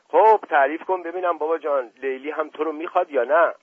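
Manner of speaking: coaxing the secret out; jolly uncle prodding nephew; playful uncle. Acted. Uncle asks warmly.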